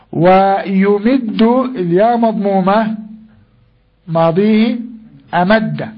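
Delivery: each form said slowly and spaced, teacher style; slow drilling of verb forms. Shown as a demonstration. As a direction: spell the conjugation out slowly for the class. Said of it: "teacher drills the verb forms". (نطق بطيء متأنٍّ للتصريف)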